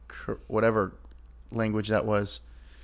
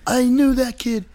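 mock character quote; 12 semitones higher, 5 dB louder, slower than usual. Mimicking a character's line.

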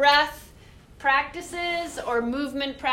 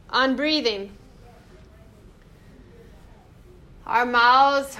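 guided meditation; 6 dB louder than usual. Acted. Leads breathing meditation.

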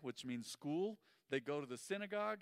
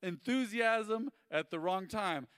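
imitating mom; 6 semitones higher, 7 dB louder than usual. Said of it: "but she said".

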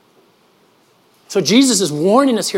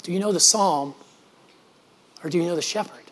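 soft probing question; 8 dB quieter than usual. Real, not acted. Closing rhetorical question.

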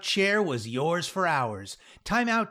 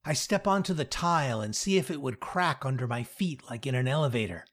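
plain reading voice

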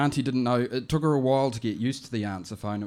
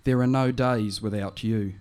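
poem reading; 5 dB louder than usual. Acted. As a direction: read it gently as a poem for the late auntie. Reads her poem.

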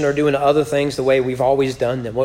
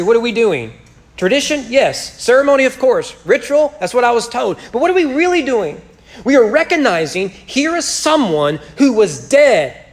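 higher, louder preaching, building with fervour; 6 semitones higher, 4 dB louder than usual. Acted. Preacher presses the congregation on the gospel, higher and louder. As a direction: drive the gospel home to the congregation.

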